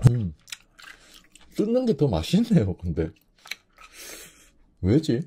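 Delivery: words between bites; chewing while talking. Real, not acted. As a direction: mouth partly full of food. Seen 0: Bites octopus before; jaw and lips show chewing during speech.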